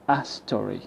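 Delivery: "a story" with the a as a weak uh; schwa in a. Shown as a demonstration. Teacher modelling the weak a in "a story", quick schwa. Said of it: "The a is replaced by the schwa".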